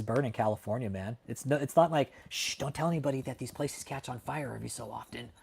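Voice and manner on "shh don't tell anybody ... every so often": hushed and much softer, a joking secret; mock hushed secret. Streamer mocking the idea that fires are secret, in a hushed joking voice. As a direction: hush it like a silly conspiracy, tongue in cheek.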